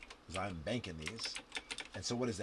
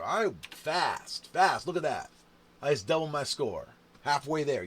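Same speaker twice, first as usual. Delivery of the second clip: louder, thrilled bragging; gleeful boasting; 4 semitones higher, 10 dB louder than usual. Real, not acted. Player showing off a doubled score to viewers, thrilled. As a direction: crow about doubling the score.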